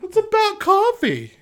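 high, loud, laughing mock rant; mock outrage. Teasing co-host over Dunkin', laughing mock outrage.

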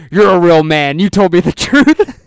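loud, booming mock praise; hammed-up cop congratulating a kid; exaggerated cop. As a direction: proclaim it like a bombastic cop. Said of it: "Are you exaggerating the way that he talked".

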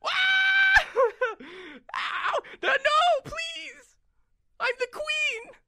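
very high shrieking gnome; bitten gnome queen. Acted. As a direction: wail and plead in a squeaky gnome voice. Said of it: "he's like".

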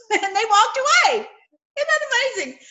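high laughing amazement. Real, not acted. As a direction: laugh while marvelling. Laughing at the story.

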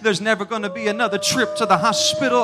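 fast, rising preaching run; fast preacher's litany building in volume; quickening litany. Acted. Sermon's rapid repeated litany.

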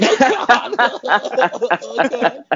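high laughing groan. Retold or imitated. Coaches reacting to the trade news, laughing high.

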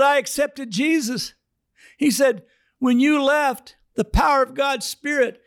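mock high elated Fred; high-voiced Fred. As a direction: burst out high with joy. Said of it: "now he doesn't talk like this".

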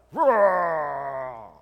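loud bodybuilder roar, falling away; flexing roar. Retold or imitated. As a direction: bellow it out while flexing hard. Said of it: "And he's like".

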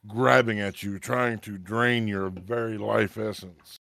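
slow dramatic scene narration; game master narration. A narrator's reading. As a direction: paint the ghost attack with menace. GM describes ghost attack.

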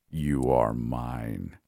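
very slow, low possessive monster drawl. Acted. The monster in her head claiming the girl, very slow and low. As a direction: stretch "You are mine" out low and slow, leaning on "are".

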